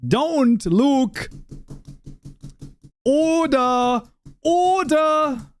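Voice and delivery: high, drawn-out shout; mock call to order. Streamer jokingly scolding Luke in chat, high mock shout. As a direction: bellow for order like a playful chairman.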